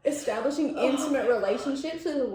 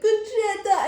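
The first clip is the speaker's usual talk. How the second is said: high, loud joyful name call; excited greeting; 11 semitones higher, 6 dB louder, slower than usual. Retold or imitated. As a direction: greet her like a long-lost friend. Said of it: "he was like".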